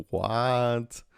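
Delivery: ordinary talk, nothing marked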